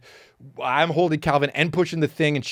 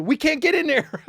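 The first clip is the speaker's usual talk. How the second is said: high, alarmed cry; panicked realization; 11 semitones higher than usual. Retold or imitated. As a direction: blurt it out in sudden panic. Realizing they're locked out.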